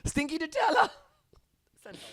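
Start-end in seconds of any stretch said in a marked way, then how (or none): 0.0-0.9 high, loud, exasperated-owner holler; yelling at the dog. Acted. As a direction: shout the pet nickname in mock scolding.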